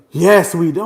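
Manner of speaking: loud high clipped yes; judge's curt reply. Retold or imitated. The judge answering the juror, loud and curt. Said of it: "the judge like".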